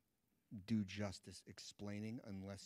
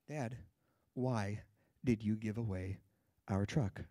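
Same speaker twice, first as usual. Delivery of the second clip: loud, punched-out words with pauses between; mock child's outrage, halting and loud; angry little kid; 8 dB louder, slower than usual. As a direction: play an indignant five-year-old confronting dad. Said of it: "an angry little five-year-old".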